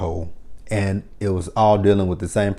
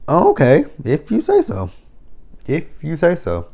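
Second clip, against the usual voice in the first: sarcastic okay; 7 semitones higher than usual. Acted. Sarcastic doubt about photo shoot.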